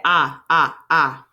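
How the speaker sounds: "ah" repeated as a separate, punched vowel; short O sound. Shown as a demonstration. Teacher asking about the middle vowel, short sound repeated. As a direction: say "ah" on its own each time. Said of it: "What about that".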